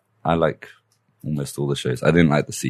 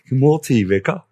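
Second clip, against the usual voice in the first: plummy mock British greeting, pitched up; British accent; 9 semitones higher than usual. To the host who asked for a British accent, a hammed-up British accent. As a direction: put on a posh British accent for a laugh. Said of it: "Can you do a British accent".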